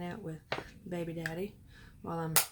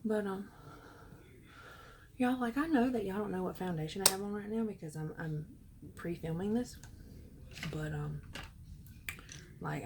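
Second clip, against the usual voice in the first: ordinary talk, nothing marked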